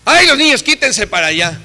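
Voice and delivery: mock irritated relative; annoyed uncle. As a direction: brush the children off. Uncle shooing kids away.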